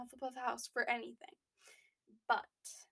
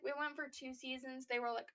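ordinary delivery

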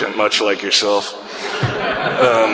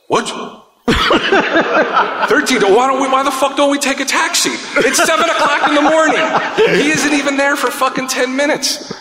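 high-pitched incredulous outburst; mock outrage. Friend balks at the bus fare in the morning, high mock outrage. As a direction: squawk it in mock outrage, much higher.

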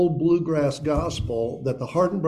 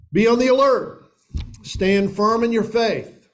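loud, higher scripture proclamation; preaching; 8 semitones higher, 4 dB louder than usual. Acted. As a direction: proclaim it to the congregation with force.

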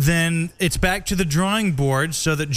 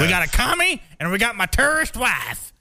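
bigoted American voice; 8 semitones higher than usual. Pretend. Mock bigoted American character.

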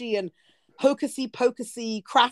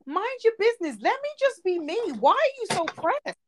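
sassy comeback; 9 semitones higher than usual. Real, not acted. Snapping back at critics.

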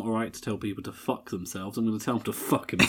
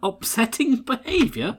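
mock disbelief; 10 semitones higher, slower than usual. Mocking Loomis's line.

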